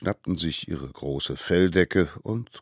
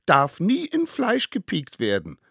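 high, loud, squeaky crowing; crowing child; 11 semitones higher, 9 dB louder than usual. Acted. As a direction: crow it out like a cheeky little one. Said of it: "krähte der Nächste". (laut krähende Kinderstimme)

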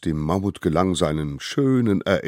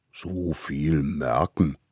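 puzzled mammoth; slower than usual. Acted. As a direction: drag it out like a slow big beast. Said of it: "wunderte sich das Mammut".

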